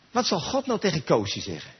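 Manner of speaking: ordinary talk, nothing marked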